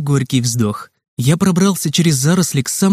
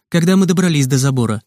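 plain reading voice

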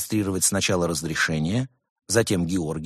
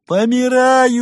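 much higher, loud mock howl of dying; hammed-up wail; 10 dB louder, much slower than usual. A narrator's reading. Gopher faking illness for the monk, loud high wail. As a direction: overplay the agony. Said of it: "схватился за живот и завыл".

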